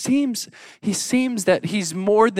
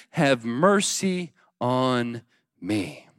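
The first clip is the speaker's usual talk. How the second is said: usual speaking voice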